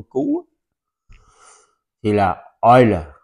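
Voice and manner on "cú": "con cú." vút lên rất cao như tiếng cú; nói "con cú." cao vút, giả tiếng cú; owl-like hoot. Pretend. Teacher mimics owl. (giả tiếng cú kêu ở "con cú.")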